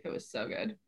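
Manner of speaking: hushed, dreamy whisper. Real, not acted. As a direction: whisper it like a fond memory. Savoring memory of crepe, breathy.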